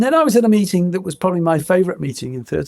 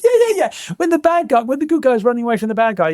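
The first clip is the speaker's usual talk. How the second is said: much higher, eager rush of words; excited Denny; 7 semitones higher than usual. Retold or imitated. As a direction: pitch it like a giddy kid with a secret plan. Denny excitedly pitching spy idea.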